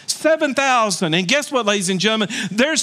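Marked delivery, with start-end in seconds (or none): 0.1-1.1 higher, punched Seven thousand; emphatic repeat. Shown as a demonstration. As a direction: ring out the number. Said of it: "Everybody say seven thousand".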